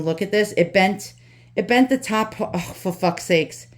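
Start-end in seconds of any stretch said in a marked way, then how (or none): none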